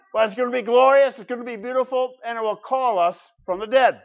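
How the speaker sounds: high, loud, rising proclamation; exultant preaching. Real, not acted. Preacher promising the trumpet will call us from the dead, exultant preaching. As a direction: burst out with it to the congregation.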